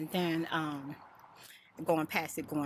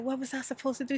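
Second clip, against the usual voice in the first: defensive plea; 9 semitones higher, faster than usual. Retold or imitated. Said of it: "I was like".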